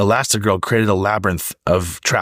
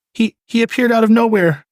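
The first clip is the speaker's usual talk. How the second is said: shaken clerk; 3 dB louder than usual. A narrator's reading. Shaken clerk after robbery.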